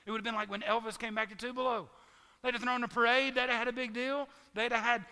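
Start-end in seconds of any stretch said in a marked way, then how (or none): 2.4-5.1 louder, higher, celebration energy; excited. Pretend. Pastor picturing a hometown welcome, loud and excited. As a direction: hype up the parade.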